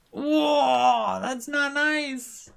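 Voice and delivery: high, loud, drawn-out whoa of protest; loud protesting outcry. Real, not acted. Player knocked back by the racing game, loud high protest. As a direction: cry out high and stretch the words. Sudden setback in the race.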